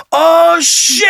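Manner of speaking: put-on high, squealed kid shout; little kid voice. Dad imitating his son in the sliding car, high little voice. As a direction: shriek it in a tiny child's voice. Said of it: "gets this little voice".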